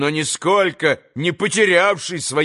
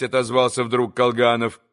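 ordinary delivery, nothing marked

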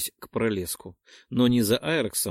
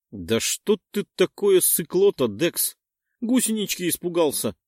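mocking taunt; 6 semitones higher than usual. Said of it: "начал откровенно издеваться Перк".

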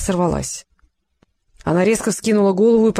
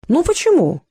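much higher, raised-voice question; loud retort; 8 semitones higher than usual. A narrator's reading. Fyodor challenges the family, loudly. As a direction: pipe up with a mildly raised voice. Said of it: "громко произнёс Фёдор".

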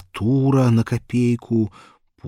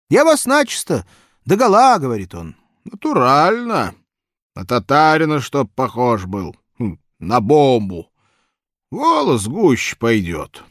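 put-on high, loud old man; 5 semitones higher, 7 dB louder than usual. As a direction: talk like a jolly old man. Barber and client voices.

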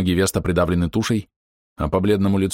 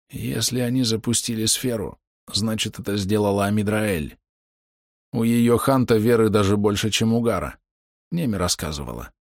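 wounded archer. A narrator's reading. Dying archer reassures friend about the sphere, weakened voice. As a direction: reason it out with failing strength.

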